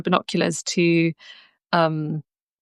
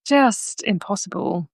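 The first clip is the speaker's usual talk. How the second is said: ordinary talk, nothing marked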